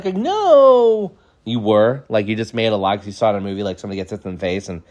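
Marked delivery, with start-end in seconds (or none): 0.2-1.1 high, drawn-out wail; hammed-up crying no; mock crying child. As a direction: deny it in a tearful whine. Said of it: "are you crying".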